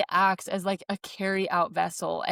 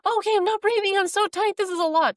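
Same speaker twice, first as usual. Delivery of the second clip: much higher, louder panicked voice; reliving the panic of labor, higher and louder; 12 semitones higher, 5 dB louder, faster than usual. Retold or imitated. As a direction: sound overwhelmed and scared. Retelling panic during birth.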